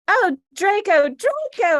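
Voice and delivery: high, loud hammed-up cry of a name; mock scream. A narrator's reading. Reading to friends, the heroine cries out her lover's name, hammed up. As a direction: overact a breathy swoon. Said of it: "I screamed while getting an orgasm".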